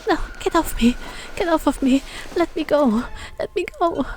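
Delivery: high, loud fighting cries; screaming struggle. A narrator's reading. June screaming at Junpei while he pulls her from the door, high and loud. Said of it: "pulling the screaming girl away".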